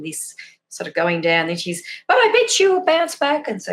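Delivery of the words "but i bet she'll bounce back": high, bright-pitched cheer-up line; chirpy reassurance. Retold or imitated. Friend reassuring the mother, chirpy. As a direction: lift her up with a bounce.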